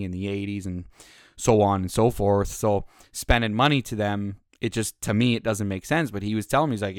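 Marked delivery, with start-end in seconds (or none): none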